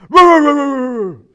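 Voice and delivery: loud, high barking roo roo; dog barking. Retold or imitated. Dog jumping on gate at kids, loud barking. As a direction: bark like a Rottweiler at the gate.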